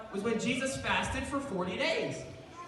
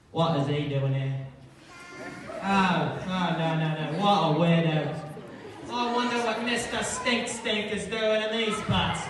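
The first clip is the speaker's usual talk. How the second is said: gossiping townsfolk; 5 semitones lower than usual. Pretend. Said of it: "I bet people were whispering".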